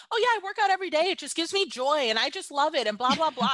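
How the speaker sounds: high, gushing mock voice of a fitness-loving blonde; sing-song mockery of a bubbly entrepreneur; mocking skinny entrepreneur. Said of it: "entrepreneurs who are, like, skinny and blonde and gorgeous".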